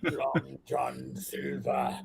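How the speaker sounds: low pirate voice. Acted.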